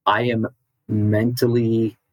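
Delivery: ordinary talk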